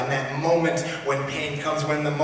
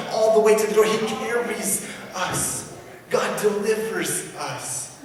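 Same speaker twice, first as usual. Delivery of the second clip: rising preacher's voice, higher and louder; preaching at full force; 8 semitones higher, 4 dB louder than usual. Acted. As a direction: preach it out to the congregation. Preaching passage.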